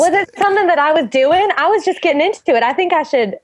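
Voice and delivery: hurt actress. Acted. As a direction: rush out a wounded defence. Actor hurt at being interrupted.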